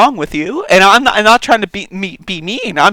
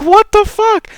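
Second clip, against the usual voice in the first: incredulous; 13 semitones higher than usual. Real, not acted. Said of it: "reacting to the comment".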